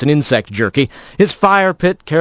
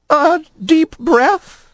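very high, slow rising question; a prim lizard taken aback; alarmed lizard voice; much slower than usual. A narrator's reading. Lizard repeats rat's words.

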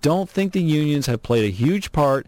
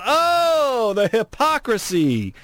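high, drawn-out cry of indignation; big, high-pitched theatrical indignation; theatrical outrage; 9 semitones higher, slower than usual. Acted. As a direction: wail it in mock-scandalized disbelief. Ranting at the state's hypocrisy.

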